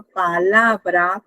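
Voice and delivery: PALABRA very slow, each syllable drawn out; pa... la... bra, drawn out for counting; syllable demo. Shown as a demonstration. Example word for counting syllables.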